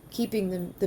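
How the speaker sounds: ordinary talk, nothing marked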